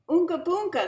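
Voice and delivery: put-on high, loud baby talk; father voice. A narrator's reading. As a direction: coo at the baby like dad. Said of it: "said her father".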